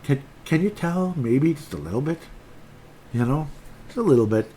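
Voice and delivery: sarcastic. Real, not acted. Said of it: "I'm getting frustrated".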